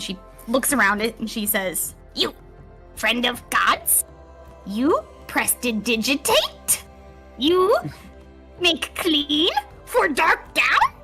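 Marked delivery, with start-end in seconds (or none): 2.1-10.9 high, slow, halting creature voice; broken speech in drawn-out, rising questions; Darkdown. Acted. Cursed sewer creature asks Zada for cleaning, high halting creature voice.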